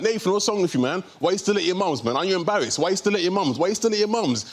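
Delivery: mock the friends nagging him; mocking mates. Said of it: "they're like".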